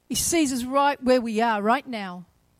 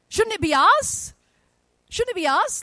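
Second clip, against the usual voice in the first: incredulous; 7 semitones higher, 5 dB louder than usual. Pretend. Incredulous question, high and loud.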